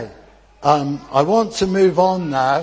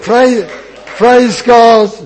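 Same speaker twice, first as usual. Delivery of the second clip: loud, high joyful cheer of praise; excited cheer; 8 semitones higher, 10 dB louder, much slower than usual. Shown as a demonstration. Preacher shows children how to cheer, excited shout. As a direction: shout it out like a happy crowd. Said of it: "I want you to be excited".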